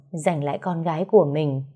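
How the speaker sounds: usual speaking voice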